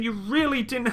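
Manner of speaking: ordinary talk, nothing marked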